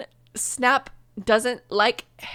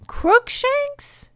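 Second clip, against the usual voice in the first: baffled; 4 dB louder than usual. Real, not acted. Baffled at the curse name.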